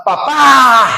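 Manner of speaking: "Papá" called out loud, falling; crying out to father. Shown as a demonstration. Preacher showing how to cry out "Papá", called loud. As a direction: call "Papá" like a child crying out to his father. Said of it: "pero clamando así".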